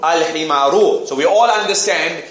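usual speaking voice

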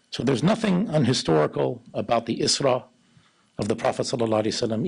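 ordinary talk

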